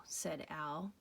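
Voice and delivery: ordinary delivery, nothing marked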